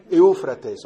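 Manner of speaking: loud, careful echo of someone else's word; echoing a prompter. Shown as a demonstration. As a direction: repeat the prompted word loudly. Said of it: "yo voy a hacer eco".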